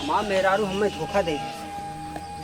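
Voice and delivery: normal talking voice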